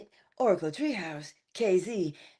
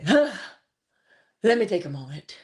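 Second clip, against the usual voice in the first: calming down; slower than usual. Real, not acted. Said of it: "getting a little worked up".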